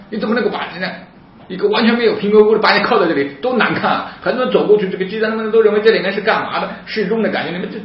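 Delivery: high, loud indignant outburst; angry, agitated. Retold or imitated. Tearful sister confronts police over public handcuffing, angry outburst. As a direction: protest at a high pitch, acting her outrage. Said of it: "很生气，".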